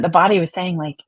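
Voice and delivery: ordinary delivery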